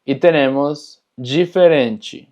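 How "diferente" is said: diferente slowly as "jiferenchi"; DI ji, TE chi. Shown as a demonstration. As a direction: open diferente on "ji", close it on "chi". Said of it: "entonces es chi". (diferente lento, dicho «jiferenchi»)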